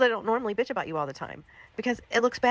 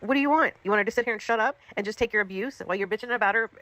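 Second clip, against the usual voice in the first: usual speaking voice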